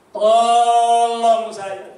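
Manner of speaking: loud, long-drawn cry for help, re-enacted; pleading cry. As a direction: stretch out a desperate plea to God. Re-enacts desperate prayer for help. (seruan minta tolong memanjang)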